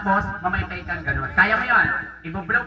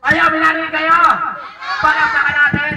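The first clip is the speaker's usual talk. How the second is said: louder, higher call to the crowd; pasigaw sa madla; 5 semitones higher, 4 dB louder than usual. Pretend. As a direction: call out to the whole audience. Calling out to the audience. (malakas na tawag sa mga manonood)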